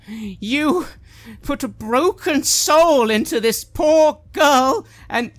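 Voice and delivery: loud, high, slow accusing yell; woman shouting blame at Tyler; angry shout. Acted. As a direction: hammer each word in fury. NPC accuses player angrily.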